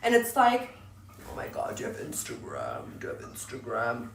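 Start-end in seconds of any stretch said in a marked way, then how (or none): 1.3-4.1 hushed, unvoiced mock-creep murmur; low, breathy parody of a drooling guy; drooling gamer dude. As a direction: whisper it like a smitten dude. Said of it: "drooling over you".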